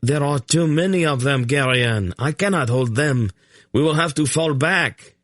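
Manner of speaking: calling out he can't hold them; shouting. A narrator's reading. Soldier to Geryon in battle, shouting. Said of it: "he shouted".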